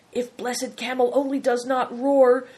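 anxious. A narrator's reading. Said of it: "said Bator anxiously".

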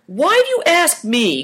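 high-pitched peevish question; complaining. A narrator's reading. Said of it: "complained Chin".

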